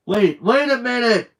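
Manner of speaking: loud high call to stop; yelling. Acted. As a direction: yell after him. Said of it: "Bully yelled".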